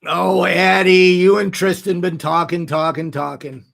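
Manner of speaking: ordinary delivery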